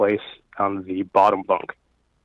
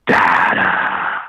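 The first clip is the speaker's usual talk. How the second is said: imitated eerie, loud stage whisper; breathy whisper; 3 semitones lower, 8 dB louder than usual. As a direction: whisper it forcefully, all breath. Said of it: "like a, a really breathy whisper".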